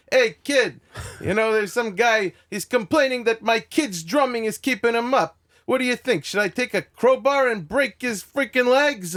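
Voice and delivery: high, loud agitated caller; hammed-up comic asking advice at full tilt; Andrew Dice Clay. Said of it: "He'd go".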